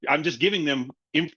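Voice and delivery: ordinary delivery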